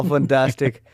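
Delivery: ordinary talk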